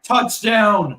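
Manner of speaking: high, loud touchdown cheer; excited shout. Real, not acted. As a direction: yell it out in disbelief. Hail Mary touchdown.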